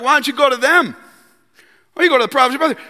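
high, loud sarcastic suggestion. Acted. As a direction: taunt him with advice you don't mean. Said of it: "he's being sarcastic".

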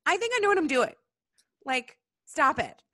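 exasperated God. Retold or imitated. God scolding doubtful Moses, high and exasperated. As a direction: roll your eyes and tell him to stop. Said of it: "God's like".

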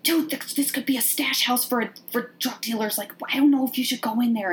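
high, voiced mock-nervous murmur; nervous whisper imitation. Podcaster voicing Bernadette warning Helen about the stash house, mock-nervous. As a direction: imitate an uneasy friend, pitched high but not truly whispered. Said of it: "is really nervously whispering to her".

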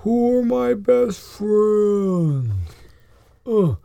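slow, drawn-out voice of a dopey giant; high, slow giant's wondering; slow giant creature. Acted. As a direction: stretch every word as a huge, puzzled beast. Game master voices giant Momo.

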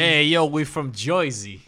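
slow, loud mock Jersey accent; thick Jersey accent. Friend playing the Jersey diners, hammed-up Jersey accent. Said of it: "they had a very thick Jersey accent".

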